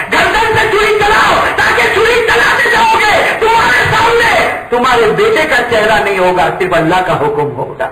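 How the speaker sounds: preaching at full force; impassioned urging. Acted. Son urges his father to strike, rising to full cry.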